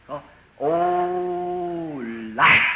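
usual speaking voice

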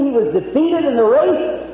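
ordinary delivery

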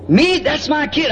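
wife's objection, high and drawn out; indignant protest. Retold or imitated. Acting out wife's protest to him.

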